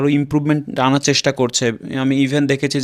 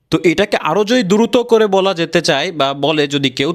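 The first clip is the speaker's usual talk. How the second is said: usual speaking voice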